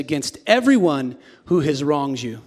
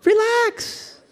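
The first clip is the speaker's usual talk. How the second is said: relax in a very high squeal; comic high-pitched relax; squeaky high relax. Shown as a demonstration. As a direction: say it like a nervous squeak. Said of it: "look at somebody next to you and go".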